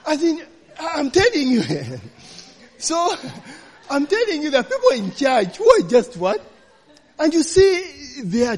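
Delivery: high, laughing through the words; can't stop laughing while telling it; giggling disbelief. Real, not acted. Laughing at the church con.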